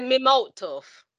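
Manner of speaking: "malt tough" stretched out slowly; slow imitation of a patois phrase. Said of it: "You just said".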